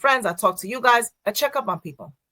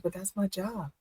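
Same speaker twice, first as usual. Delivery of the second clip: inner thought, quiet; 12 dB quieter than usual. Retold or imitated. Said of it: "I'm like, in my mind".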